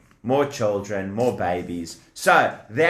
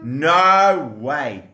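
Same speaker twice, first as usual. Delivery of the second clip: usual speaking voice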